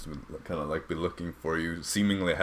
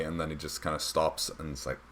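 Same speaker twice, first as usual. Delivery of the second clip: ordinary talk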